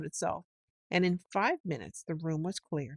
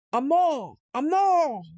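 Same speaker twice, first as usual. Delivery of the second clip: much higher, louder shouted battle cry; war cry; 9 semitones higher, 8 dB louder than usual. A narrator's reading. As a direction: roar it as you charge in. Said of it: "shouting his awful war cry".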